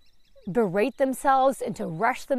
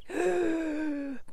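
panicked gasp; 4 semitones higher than usual. Retold or imitated. Panic on waking.

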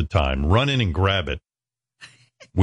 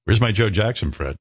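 ordinary delivery

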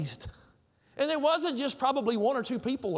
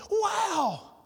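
excited; 6 semitones higher, slower than usual. Retold or imitated. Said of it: "still kind of floating through the air".

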